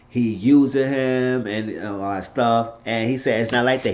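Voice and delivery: ordinary talk, nothing marked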